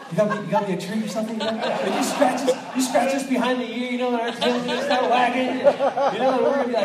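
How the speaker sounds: cracking up while telling it; real laughter mid joke; laughing. Real, not acted. Dog analogy told laughing.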